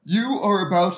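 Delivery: words stretched by a yawn; talking through a yawn. Real, not acted. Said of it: "sorry, I was yawning there".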